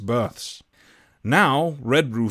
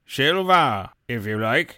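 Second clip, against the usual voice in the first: squire crying out; slower than usual. A narrator's reading. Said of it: "cried the squire".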